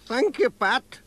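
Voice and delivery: put-on high, friendly old woman; sweet elderly village lady. A narrator's reading. Elderly postmistress voiced in story.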